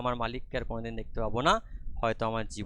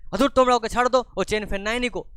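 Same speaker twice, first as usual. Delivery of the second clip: put-on high, loud, furious child; angry little girl; 10 semitones higher, 8 dB louder, faster than usual. Leela angrily tells them to let the boy go. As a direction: snap at the grown-ups. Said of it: "লীলা রেগে মেগে বলছে".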